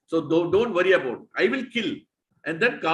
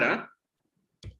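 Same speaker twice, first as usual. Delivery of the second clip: usual speaking voice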